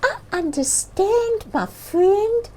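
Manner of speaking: a tiny bird comforting the bear; gentle bird voice. Acted. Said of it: "Bird gently replied".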